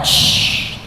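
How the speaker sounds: soft unvoiced shhh of a spray; breathy spray sound effect; spraying hiss. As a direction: hiss like a little stream squirting. Baby spraying pee sound.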